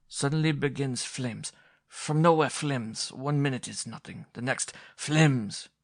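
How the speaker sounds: put-on slow, high German-accented telling; German director describing fire. A narrator's reading. Director recounting the fire in a German accent. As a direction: spread the flames with wonder, German accent.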